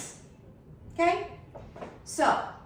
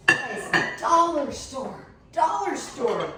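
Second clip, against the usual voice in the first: louder, slow, drawn-out emphasis; excited bargain brag; 3 semitones higher, 4 dB louder, much slower than usual. Real, not acted. Vlogger showing off cheap plates to viewers, loud slow excitement. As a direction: sell the bargain with delight.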